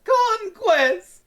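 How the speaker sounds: high, loud, rising villain cry; hammy villain cry. Retold or imitated. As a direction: cry it out like a ruined tyrant. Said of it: "The way he says it".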